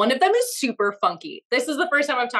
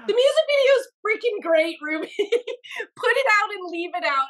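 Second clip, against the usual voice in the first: higher and louder, urging; excited friend; 9 semitones higher, 3 dB louder than usual. Retold or imitated. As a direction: cheer her on to release it. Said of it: "He's like".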